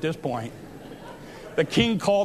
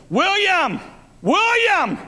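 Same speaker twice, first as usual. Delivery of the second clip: very high, loud wifely holler; calling out; 11 semitones higher, 4 dB louder, much slower than usual. Retold or imitated. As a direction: call a husband from the balcony, pitched way up. Wife calling from the balcony.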